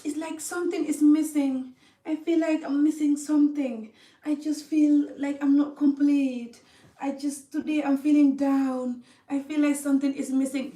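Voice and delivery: lost woman. Retold or imitated. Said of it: "You feel, you're like".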